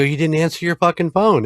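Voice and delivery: exasperated scolding. Retold or imitated. Son scolding his mom for ignoring calls, exasperated. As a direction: chew her out, half-joking.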